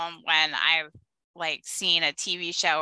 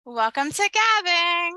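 high, loud announcer welcome; show intro; 11 semitones higher, 5 dB louder than usual. Acted. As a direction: greet listeners like a host.

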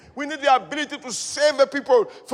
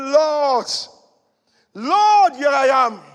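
Lord, Lord, cried out in surrender; loud cry to the Lord; 2 semitones higher, 8 dB louder, much slower than usual. Retold or imitated. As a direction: cry out with all your heart. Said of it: "I said".

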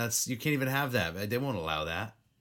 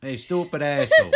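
laughing; 12 semitones higher, 10 dB louder than usual. Pretend. Saying name as an insult.

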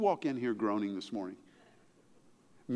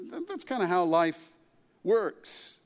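ordinary talk

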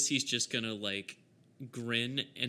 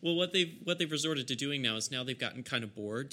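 ordinary talk, nothing marked

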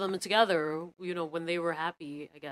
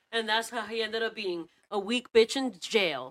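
normal talking voice

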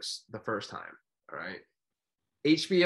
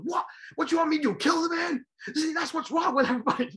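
high, loud outraged shout; indignant yell; 4 dB louder than usual. Retold or imitated. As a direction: fire back, incredulous. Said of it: "He goes".

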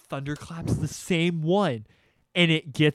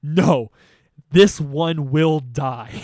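louder, very slow defiance; determined no; 10 dB louder, much slower than usual. Retold or imitated. Son refuses his dad, loud and slow. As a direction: refuse and mean it. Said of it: "And I was like".

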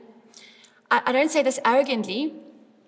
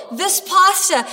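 ordinary talk

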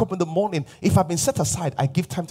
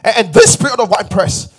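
loud, raised-pitch proclamation; emphatic preaching; 7 semitones higher, 9 dB louder than usual. Real, not acted. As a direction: proclaim it forcefully to the congregation. Preacher surges into emphatic declaration.

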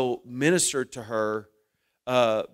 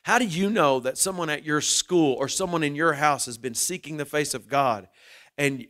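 ordinary talk, nothing marked

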